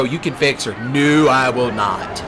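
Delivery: normal talking voice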